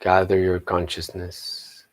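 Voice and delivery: slow, low meditation voice with long pauses; guided meditation. Acted. Teacher leading the morning group into meditation, slow and hushed guided-meditation voice.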